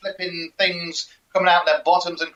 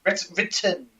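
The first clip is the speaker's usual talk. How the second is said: Essex "written" with a glottal stop for the t; "written" said "wri'en", t swallowed Essex style; Essex accent; 3 semitones higher, 4 dB quieter, slower than usual. Shown as a demonstration. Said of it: "That's the Essex version".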